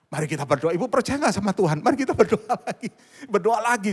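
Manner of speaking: high, loud rallying call; re-enacted urging to pray. Pastor urging worried mother to pray again, loud and insistent.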